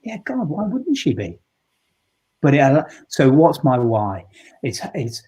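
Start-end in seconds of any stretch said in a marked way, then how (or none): 0.0-1.4 sudden realization. Retold or imitated. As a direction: let it dawn on you, pitched way up. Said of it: "a moment I thought".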